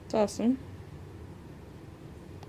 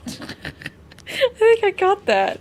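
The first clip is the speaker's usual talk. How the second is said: laughing; 13 semitones higher, 11 dB louder, much slower than usual. Real, not acted. Giggling after fighting air bubble.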